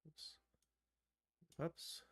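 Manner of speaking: ordinary delivery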